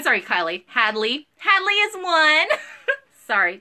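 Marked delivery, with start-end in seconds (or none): 1.4-2.6 high, slow cooing; baby talk. Acted. Seller corrects the toddler's name in high baby talk. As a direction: coo it out like to a toddler. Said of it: "talking like you're the baby".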